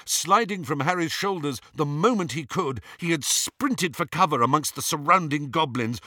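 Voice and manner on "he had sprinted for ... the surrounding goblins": plain reading voice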